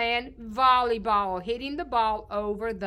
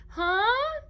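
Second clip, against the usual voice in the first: very high rising questioning huh; exaggerated high-pitched question; voice going up high; 11 semitones higher, 3 dB louder than usual. Shown as a demonstration. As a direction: slide right up at the end. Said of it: "your voice will go up high".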